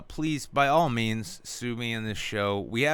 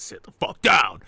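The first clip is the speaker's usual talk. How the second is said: barked order, louder; gruff yell; 7 dB louder than usual. Retold or imitated. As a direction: bark it like an angry host. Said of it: "He says".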